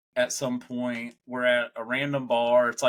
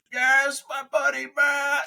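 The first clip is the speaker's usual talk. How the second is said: high, loud cheerful shout; high, loud announcement by the drunk friend; 14 semitones higher, 4 dB louder, slower than usual. Retold or imitated. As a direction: shout the name out proudly like a drunk buddy.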